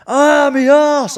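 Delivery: high, loud, drawn-out yelp of pain; hammed-up falsetto cry of pain; mock pained squeal. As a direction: squeal it like a burnt woman. Girlfriend burned by the hot bulb.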